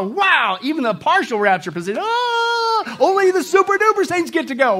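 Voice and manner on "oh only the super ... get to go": high, hammed-up lament; comic high-pitched dismay, louder at the end; mock wail. Mocking the partial rapture position.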